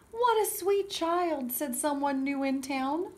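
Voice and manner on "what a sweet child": put-on higher, slow gushing over a child; lightly voiced sweet admiration; admiring newcomer. Newcomer admiring the little girl, gushing.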